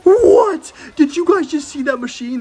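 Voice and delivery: sudden high shocked yelp, then disbelief; can't believe what just happened. Real, not acted. Opponent's surprise play.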